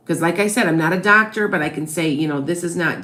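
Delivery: ordinary talk, nothing marked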